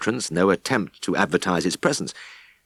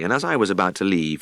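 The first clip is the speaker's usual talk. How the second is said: plain reading voice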